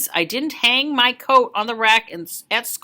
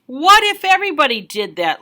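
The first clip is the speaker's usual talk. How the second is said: louder, stern scolding; disapproving lady; 4 semitones higher, 5 dB louder than usual. Acted. Cake table lady scolding the kid, stern. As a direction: glare and scold over your glasses. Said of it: "glared at me over her glasses and said".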